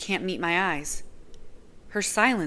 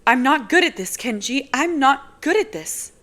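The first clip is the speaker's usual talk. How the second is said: higher, awkward apology; embarrassed girl apologizing; apologetic young woman; 8 semitones higher, 7 dB louder than usual. Acted. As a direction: sound sorry and out of your depth. Nazira insisting.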